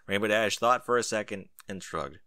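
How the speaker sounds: usual speaking voice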